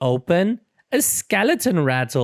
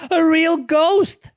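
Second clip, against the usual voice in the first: much higher, slow, fearful cry; frightened; 6 dB louder than usual. A narrator's reading. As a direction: gasp it out in fright. Friends panic at the ghost.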